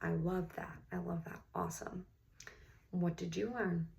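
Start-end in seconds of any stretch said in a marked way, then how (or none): none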